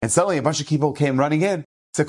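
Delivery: usual speaking voice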